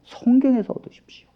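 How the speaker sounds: hushed whisper, each word breathed. Real, not acted. Preacher whispering the answer to the congregation, breathed. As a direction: whisper 성경에서 얻으십시오 as a secret to the congregation.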